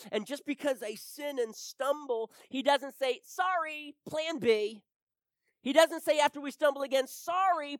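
loud, high, emphatic preacher's voice; full-force preaching. Real, not acted. Preacher insisting God has no plan B, full-force preaching. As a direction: hammer it out to the congregation.

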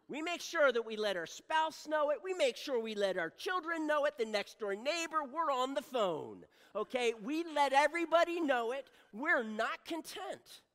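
loud, rising preacher's build; preaching at full force. Acted. Preacher rousing the congregation, loud and building. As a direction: hammer it home to the congregation.

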